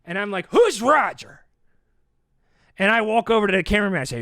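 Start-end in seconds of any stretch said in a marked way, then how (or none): 0.5-1.4 high, raised-voice demand; acted outraged question; angry demand. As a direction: burst out in indignant challenge. Demanding to know who Roger is.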